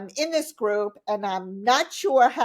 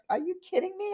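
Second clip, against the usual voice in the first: high, softer incredulous question; stunned disbelief; 10 semitones higher, 4 dB quieter, faster than usual. Retold or imitated. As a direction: act out being shocked at first place. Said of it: "I was like".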